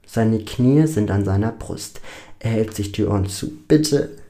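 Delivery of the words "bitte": high, strained plea that climbs; desperate screaming. A narrator's reading. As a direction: beg them to stop, voice pushed very high. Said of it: "schreit er laut". (verzweifeltes, hohes Schreien)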